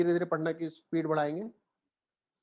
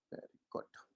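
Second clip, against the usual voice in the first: whisper; 18 dB quieter, much slower than usual. Real, not acted. Teacher murmurs praise.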